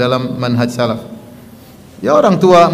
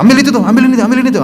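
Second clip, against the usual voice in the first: higher, curt commands tossed at him; bossy ordering; 10 semitones higher, much faster than usual. Retold or imitated. Wife barking orders at her husband, bossy and impatient. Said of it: "Kalau sama suami tidak".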